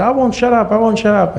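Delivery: high-pitched defiant retort; defiant mother. Retold or imitated. Wife refusing husband's order, defiant mock yelling. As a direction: imitate stubborn outrage, voice way up.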